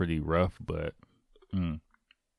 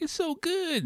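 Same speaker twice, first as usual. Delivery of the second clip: much higher, mock-gushing friend; gushing praise; 3 dB quieter, slower than usual. Imitating his college friend raving about the fruit sandwich, high and gushing.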